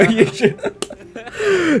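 talking through laughter; teasing while laughing. Real, not acted.

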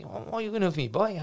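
light Leicester boss impression; broad Leicester accent. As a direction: put on the Leicester owner's broad accent, half-mocking. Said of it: "broad Leicester accent".

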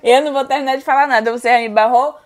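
loud, indignant through laughter; laughing protest. Real, not acted. Player refuses to go on after being blocked, laughing protest. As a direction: protest loudly while cracking up.

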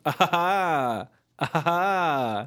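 drawn-out staccato "ah, ha, ha"; soundbite impression. Showing off a favourite soundbite as a would-be ringtone, imitated laugh. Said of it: "that's a decent impression of it".

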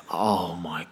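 hushed, breathy panic under the breath; a frantic whispered curse; panicked whisper. Retold or imitated. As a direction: whisper it in horror so no one hears. Seeing the stain on the sheets.

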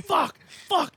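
high, hammed-up "fuck, fuck"; fake frustration. Letting his dad win, faking frustration. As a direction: pretend to be annoyed at losing. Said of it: "I would be like".

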